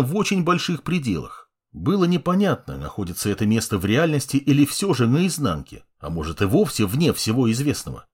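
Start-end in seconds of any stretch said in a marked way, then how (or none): none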